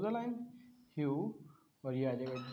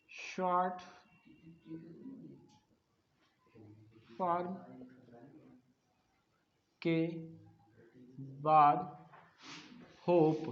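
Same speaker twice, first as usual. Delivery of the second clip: dictating word by word with long pauses; slow dictation; 4 dB quieter, slower than usual. Shown as a demonstration. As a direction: read each word out for students to write down. Reads next rule as dictation.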